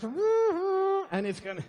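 put-on the accent gives out mid-word and the pitch jumps way up; Scottish accent slipping. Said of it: "I keep dropping out of it".

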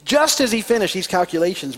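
ordinary talk

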